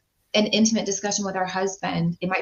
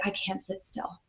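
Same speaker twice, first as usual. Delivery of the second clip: much softer, as a client confessing; quiet client quote; 12 dB quieter than usual. Retold or imitated. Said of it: "some people will come to me and say".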